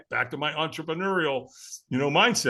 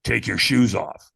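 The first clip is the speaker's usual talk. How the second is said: Tony's command; 7 semitones lower, 5 dB louder, slower than usual. Retold or imitated. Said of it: "Tony goes".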